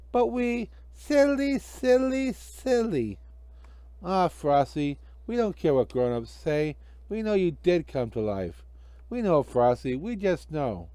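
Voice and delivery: put-on high, earnest kid voices; children. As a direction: kids reassuring Frosty. Children comfort Frosty.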